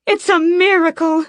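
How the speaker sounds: very high, loud joyful exclaim. A narrator's reading. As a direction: burst out in rapture. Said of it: "surges up and exclaims".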